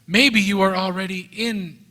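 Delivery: usual speaking voice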